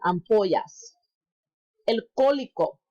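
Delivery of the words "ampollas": "Ampollas" with the double L as a Y sound. Shown as a demonstration. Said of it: "a double L makes a Y sound".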